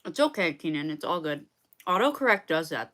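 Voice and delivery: ordinary talk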